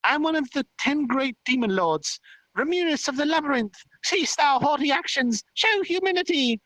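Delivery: high, squeaky fairy proclamation; happy proud boast. Acted. Tiny demon lord introducing herself properly, happy and proud. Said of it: "she happily said".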